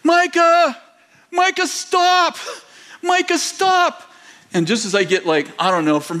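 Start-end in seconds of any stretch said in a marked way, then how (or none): 0.0-4.0 high, loud shout, drawn out slowly on stop; desperate yell across the yard; yelling to stop him. Retold or imitated. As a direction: call out in alarm to a grandson. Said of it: "I'm like".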